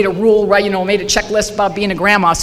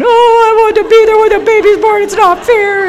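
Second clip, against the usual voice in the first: much higher, whiny crying voice; imitated wailing, pitched way up; retold crying; 13 semitones higher, 8 dB louder than usual. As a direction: whimper it out like a hurt child. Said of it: "I start crying".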